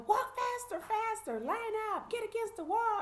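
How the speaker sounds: high, loud hollered orders; imitated screaming sheriffs. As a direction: holler commands like a mean sheriff. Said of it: "screaming and hollering at us".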